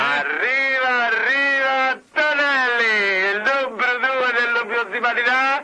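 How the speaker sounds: slow hyped intro; drawn-out announcement. Acted. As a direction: stretch each word like a ringside announcer.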